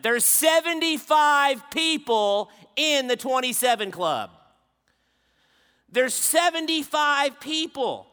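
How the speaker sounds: loud, high repeated stress on seventy-five; emphatic preaching. Real, not acted. Preacher pressing the count on his congregation, loud and high. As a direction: hammer the number seventy-five home.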